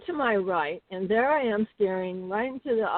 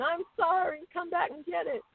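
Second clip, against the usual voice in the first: higher call out to a distant animal; crying out; 9 semitones higher than usual. Retold or imitated. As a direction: call after the mountain lion, apologetic. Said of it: "I'm crying out to the mountain lion".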